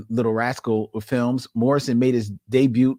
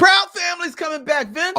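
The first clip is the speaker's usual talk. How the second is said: high, loud excited exclamation; excited outburst; 4 dB louder than usual. Real, not acted. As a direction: burst out with it, pitched way up. Excited outburst at show news.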